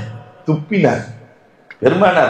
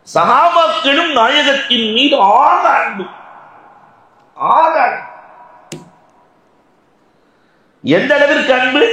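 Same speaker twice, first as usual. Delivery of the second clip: impassioned preaching; 10 semitones higher than usual. Real, not acted. Preacher tells congregation of companions' devotion, impassioned preaching. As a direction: proclaim it in a soaring pulpit voice.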